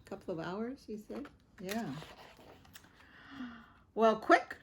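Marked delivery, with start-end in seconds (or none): 3.9-4.6 urgent call, a bit louder; Dad crying out. A narrator's reading. Dad urging the family to rush, loud call. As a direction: hurry them along. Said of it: "cried Dad".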